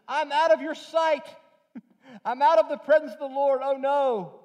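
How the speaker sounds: high, loud, laughing mock panic; a hammed-up Jonah realising he's out of God's sight; panicked Jonah. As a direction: play Jonah suddenly terrified he's truly gone. Jonah voiced realising he's lost.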